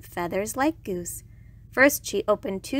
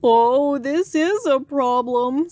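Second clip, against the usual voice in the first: loud, slow, put-on walrus voice; worried walrus; 4 semitones higher, 5 dB louder, slower than usual. A narrator's reading. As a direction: fret loudly and slowly as a walrus. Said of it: "worried Walrus".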